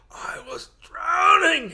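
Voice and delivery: put-on high, loud, slow whine of a crying kid; hammed-up sobbing protest; mock crying. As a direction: wail it like a wronged child. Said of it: "I'm just like".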